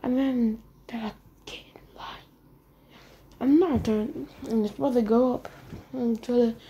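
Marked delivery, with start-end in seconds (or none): 1.5-2.3 barely audible, whispered echo; very soft, breathy-quiet echo of an order; hushed echo. Retold or imitated. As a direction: repeat the order under your breath.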